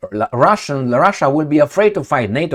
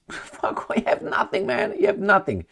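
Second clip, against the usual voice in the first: laughing whisper; 6 semitones higher, 8 dB quieter than usual. Real, not acted. Laughing aside to himself.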